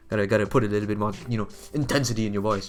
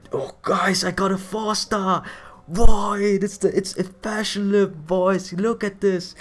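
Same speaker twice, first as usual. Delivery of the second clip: excited; 9 semitones higher, slower than usual. Retold or imitated. Retelling own gacha excitement.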